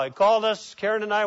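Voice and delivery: usual speaking voice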